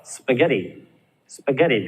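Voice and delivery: "spaghetti" with a weak spə, stress on GET; schwa in spaghetti. Shown as a demonstration. Teacher models "spaghetti" with schwa after spa. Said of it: "because the stress is in the get".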